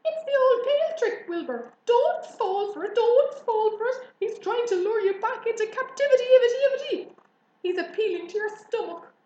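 high, loud squawked warning; alarmed goose. A narrator's reading. Goose warning pig of pail trick, alarmed. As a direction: warn frantically, like a squawking goose. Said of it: "said the goose".

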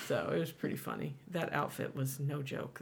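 usual speaking voice

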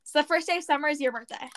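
normal talking voice

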